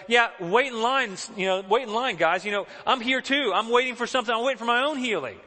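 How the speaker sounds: loud, high-pitched impatient brush-off, imitated; indignant crowd. Crowd at the door turning away the friends, indignant.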